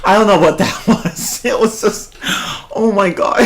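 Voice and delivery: words breaking into giggles; laughing. Real, not acted. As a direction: talk through real laughter. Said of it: "I'm just laughing at it".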